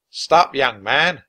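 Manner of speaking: loud, slow order; commanding halt. A narrator's reading. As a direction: stop him in his tracks. Captain halts Jack.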